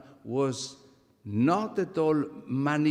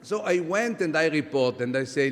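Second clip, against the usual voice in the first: ordinary delivery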